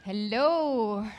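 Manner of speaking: ordinary delivery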